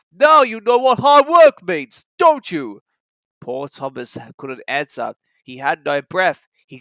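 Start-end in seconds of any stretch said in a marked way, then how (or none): 0.1-2.8 high, loud smug teasing; smug chuckle. A narrator's reading. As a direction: rub it in smugly. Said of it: "chuckled Gordon".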